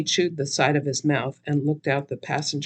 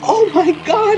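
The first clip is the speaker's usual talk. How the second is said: high, loud startled yell; 9 dB louder than usual. Acted. Narrator yelling as the car shoots forward, loud. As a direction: scream it as the car lurches. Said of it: "I yelled".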